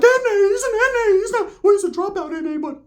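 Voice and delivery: very high whiny falsetto; mock frantic pleading. [name] butting in to the biker's accusation, frantic falsetto. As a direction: squeal the objection in a cartoon voice.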